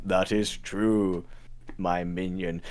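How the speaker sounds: low, slow, hushed master voice; demon master. Acted. Demon master confirming a follower's claim, low and slow. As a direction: answer slowly from down low, quietly lordly.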